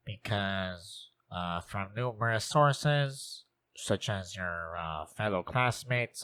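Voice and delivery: a lightly voiced awkward teacher eating his words; sheepish teacher apology. Teacher apologizes to student for calling him stupid, sheepish and soft.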